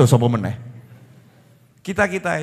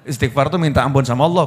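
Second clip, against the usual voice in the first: usual speaking voice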